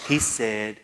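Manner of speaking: ordinary talk, nothing marked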